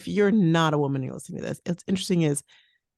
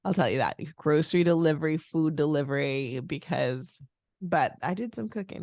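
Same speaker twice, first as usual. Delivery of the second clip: normal talking voice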